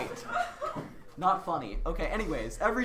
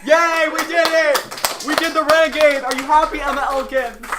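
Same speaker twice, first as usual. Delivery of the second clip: loud, joyful cheer; excited cheering; 8 semitones higher, 11 dB louder than usual. Real, not acted. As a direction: shout it out in celebration. Celebrating finishing the dance.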